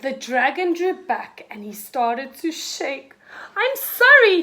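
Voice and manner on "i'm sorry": high, loud flustered apology; spluttered. A narrator's reading. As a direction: sputter it out, scared and high. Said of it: "he spluttered".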